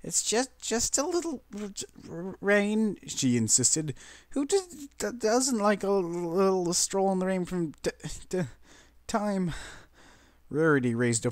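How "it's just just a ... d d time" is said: shiver out the words; chattering teeth. A narrator's reading. Pinkie insisting to friends, stuttering with chattering teeth. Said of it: "through her chattering teeth".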